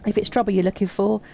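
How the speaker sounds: put-on irate old craftsman. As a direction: bristle at the newcomer who nearly hurt him. Voices angry NPC.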